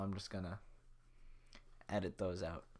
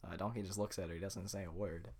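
ordinary delivery

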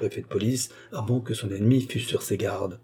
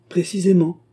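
crisp confirmation, higher and louder; imperturbable composure; 8 semitones higher, 6 dB louder than usual. A narrator's reading. Baccarat answers about her jailed lover, imperturbably. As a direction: confirm it firmly, without embarrassment. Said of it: "répondit-elle avec un imperturbable sang-froid".

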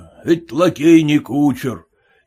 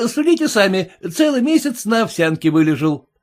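higher, eager servant voice; self-assured servant, higher pitch; boastful lackey; 9 semitones higher than usual. A narrator's reading. Lackey boasts of his belly.

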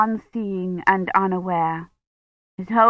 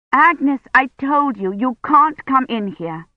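higher-pitched, impatient scolding; 6 semitones higher than usual. A narrator's reading. Kivrin tells Agnes to stay out, impatient. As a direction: scold a child who keeps interrupting. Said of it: "Kivrin said impatiently".

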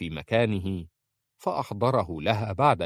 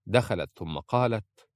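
plain reading voice

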